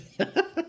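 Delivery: squeaky breathless giggle; high wheezing laugh. Real, not acted. Streamer still laughing at his fail, squeaky giggle. Keeps cracking up.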